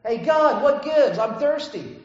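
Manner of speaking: high, loud whiny demand at the sky; a hammed-up complainer; mock complaint to God. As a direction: play the thirsty grumbler shouting up at God. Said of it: "and says".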